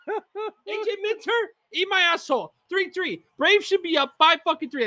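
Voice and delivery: high-pitched fuming rant; seething fan rant. Real, not acted. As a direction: vent at the pitcher, still boiling. Still raging at the game.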